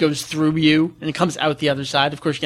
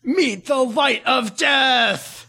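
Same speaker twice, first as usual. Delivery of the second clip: high, slow shouted attack name; villain battle cry; 8 semitones higher, much slower than usual. Retold or imitated. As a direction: bellow the attack like an anime villain. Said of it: "Is that what he says?".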